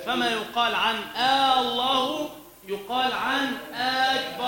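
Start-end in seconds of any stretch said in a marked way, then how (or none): 1.1-4.4 آه الله with a drawn-out questioning آه before الله; wrong questioning hamza. Shown as a demonstration. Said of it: "كأنه يستفهم".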